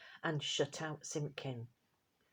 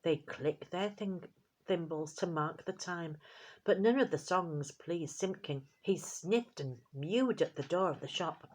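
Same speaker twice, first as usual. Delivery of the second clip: plain reading voice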